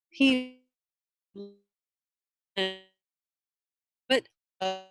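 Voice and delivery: audio cutting out on a bad connection; choppy, breaking-up video call; call dropping out. Real, not acted. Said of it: "you are breaking up a lot".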